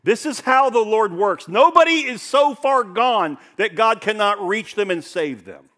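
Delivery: loud, high, fervent proclamation; full-force preaching. Real, not acted. Preacher urging the congregation, loud and high. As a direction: cry it out to the whole church.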